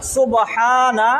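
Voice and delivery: সুবহানা drawn out very slowly; slow, careful সুবহানা for students to repeat; slow recitation. Shown as a demonstration. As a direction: stretch every sound of সুবহানা. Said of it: "আমার সাথে বলো".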